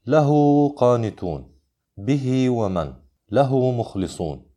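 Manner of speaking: slow examples, drawing out the endings of "له" and "به"; short connecting madd. Shown as a demonstration. Teacher reads minor connection examples, "له" and "به" with lengthened endings. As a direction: lengthen "له" to lahoo and "به" to bihee. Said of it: "الأمثلة على الصلة الصغرى".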